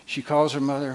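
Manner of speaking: usual speaking voice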